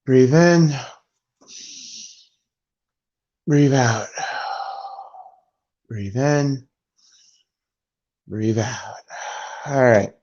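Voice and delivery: low, measured breathing coaching to oneself; self-calming. Real, not acted. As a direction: talk yourself down, pitch dropping, pauses between breaths. Calming himself down after screaming.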